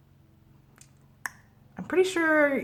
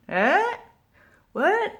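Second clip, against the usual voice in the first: high, questioning little prompts; mock prompting; 13 semitones higher than usual. Vlogger playfully coaxing the answer from viewers, mock prompting. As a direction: pretend to strain for the audience's answer.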